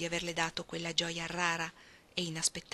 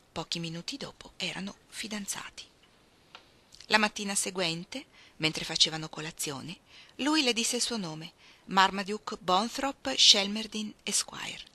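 plain reading voice

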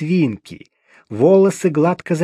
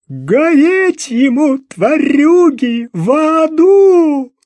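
much higher and louder, slow, dragged-out curse; howling curse; 12 semitones higher, 7 dB louder, slower than usual. Acted. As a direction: curse him to hell, howling. Mother cursing her son's killer. (проклинает, высоко и громко, растягивая)

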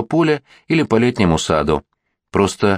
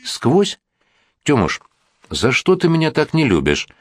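put-on childlike plaintive; 7 semitones higher than usual. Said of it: "По-детски жалобно".

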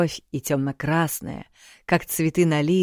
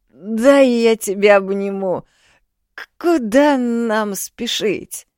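loud, higher tipsy gushing; drunk; 6 semitones higher, 4 dB louder than usual. A narrator's reading. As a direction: gush like a merry drunk. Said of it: "осоловел".

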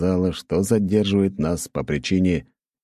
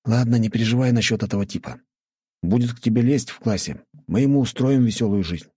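higher-pitched friend backing up a pal; friend reassuring; 6 semitones higher than usual. A narrator's reading. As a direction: reassure him in the raised friend's voice. Narrator voices friend reassuring. (друг подбадривает, голос повыше)

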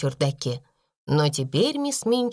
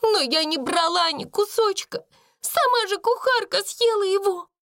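very high, tearful protest; acted desperate outcry; despairing; 13 semitones higher than usual. A narrator's reading. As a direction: wail your innocence in a girlish voice. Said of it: "в отчаянии проговорила она".

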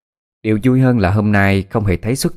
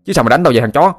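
loud, rising angry taunts; furious defiant outburst; red-faced anger; 4 semitones higher, 4 dB louder, faster than usual. A narrator's reading. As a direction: dare him to curse back, climbing higher and louder. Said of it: "Cầm đỏ mặt tía tai".

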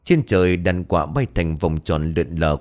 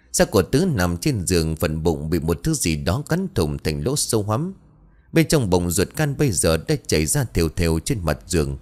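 plain reading voice

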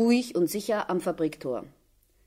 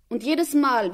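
normal talking voice

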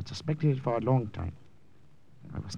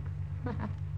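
nervous laugh; 8 semitones higher, 5 dB quieter than usual. Acted.

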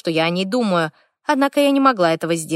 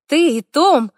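high, thrilled exclamation; delighted chatter; 8 semitones higher, 4 dB louder, slower than usual. A narrator's reading. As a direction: squeal the names with joy. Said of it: "восторженно щебетала".

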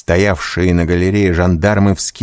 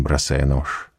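ordinary delivery

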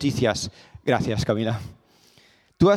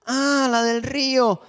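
dawning recollection; 9 semitones higher, slower than usual. Retold or imitated. Calm monk finally remembers.

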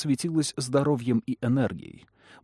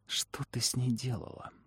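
tense, hushed warning; 9 dB quieter than usual. A narrator's reading. Trish confronting Lamia, frightened.

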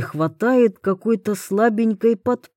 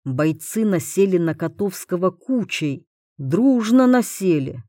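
ordinary delivery, nothing marked

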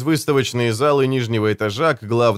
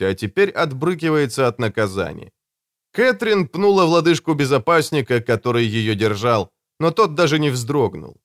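ordinary delivery, nothing marked

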